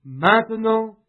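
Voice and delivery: normal talking voice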